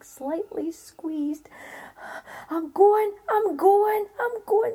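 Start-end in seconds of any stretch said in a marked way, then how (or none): 2.5-4.7 high, loud, hurried giving in; scrambling to obey out of fear; frightened giant. A narrator's reading. Giant obeying Jack in a panic, high and loud.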